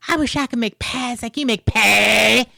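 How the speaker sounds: mock Southern accent. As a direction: parody the movie line in an overdone Southern accent. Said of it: "It sounds like Eric Cartman".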